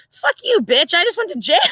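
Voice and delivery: high-pitched comic outrage; mock indignant retort. Wife answering her husband after jail, mock indignation. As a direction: fire back in a high voice, half laughing.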